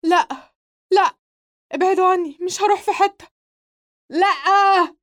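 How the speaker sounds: high panicked screams, pushing them away; terrified screaming. Acted. Nael waking from his nightmare, screaming in terror. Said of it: "نائل صارخا".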